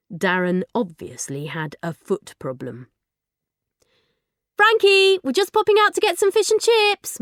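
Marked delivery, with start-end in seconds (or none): 4.6-7.2 loud, high call up the stairs; calling from upstairs. Acted. Mum calling down to her daughter from upstairs, loud call. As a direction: call it out to reach the basement. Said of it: "Mum called from upstairs".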